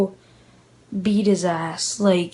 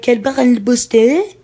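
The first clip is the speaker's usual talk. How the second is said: imitating a babbled French question, higher pitched; babbling French; 4 semitones higher, 4 dB louder than usual. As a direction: babble mock French, rising at the end. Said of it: "he just started babbling on".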